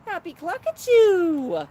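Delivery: high, drawn-out loud coo; playful bird talk. Pretend. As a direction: sing it out to a pet bird. Cheering on a bird outside.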